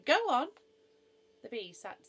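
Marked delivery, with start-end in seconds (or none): 0.0-0.5 high, loud encouragement; jubilant, pitched-up coaching; excited cry. A narrator's reading. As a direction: urge the speller on eagerly. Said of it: "cried Noah".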